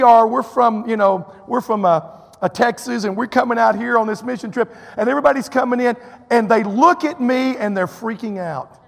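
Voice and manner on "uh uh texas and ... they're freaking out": usual speaking voice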